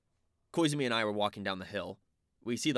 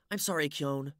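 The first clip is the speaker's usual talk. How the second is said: apologetic; 6 semitones higher than usual. Acted. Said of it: "Suya said apologetically".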